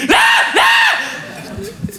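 very high-pitched shriek; mock scream. Stranger screaming to metro agents, mock high-pitched scream. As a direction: imitate a startled woman screaming. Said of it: "se retourne et crie".

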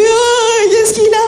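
high, drawn-out mock alarm; put-on high worried voice; imitating worried partner. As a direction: wail the question like a helpless dad. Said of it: "mon mec, il arrive comme ça".

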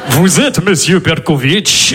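put-on slow deep Russian accent; menacing Russian gangster; Russian-accented low menace. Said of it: "Je fais bien l'accent comme ça".

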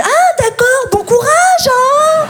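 very high-pitched, hammed-up well-wishing; earnest encouragement. Neighbour takes the begging joke seriously, overly encouraging. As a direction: wish good luck in all seriousness, exaggerated. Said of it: "Premier degré, il m'a répondu".

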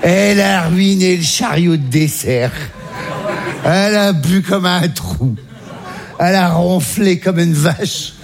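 very slow, drawn-out recital of misdeeds; slow exasperated listing. Real, not acted. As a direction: spell out each offence slowly, appalled.

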